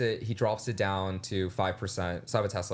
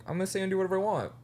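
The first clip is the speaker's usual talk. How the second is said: fast and higher, brazen; defiant; 7 semitones higher, much faster than usual. Retold or imitated. Declaring she'll do whatever she wants, fast and brazen. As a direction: rattle it off with cheeky bravado. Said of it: "but I'm like".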